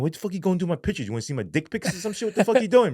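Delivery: high, fast, incredulous questions; retold indignant outburst. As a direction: object in rapid-fire outrage. Outraged at pictures being searched.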